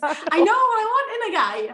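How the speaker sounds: laughing mid-sentence. Real, not acted. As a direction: speak through a laugh. Laughing about her dating.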